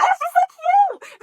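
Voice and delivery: very high, loud, drawn-out squeal; fangirl squeal. Real, not acted. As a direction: squeal at unbearable cuteness. Squealing over cute character.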